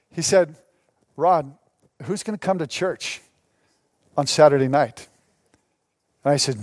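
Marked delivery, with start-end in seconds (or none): 1.2-5.1 low, dry sceptical question; pitched down, halting between phrases; deadpan doubt. Retold or imitated. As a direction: imitate a doubting pastor, low with pauses. Retelling senior pastor's doubting reply.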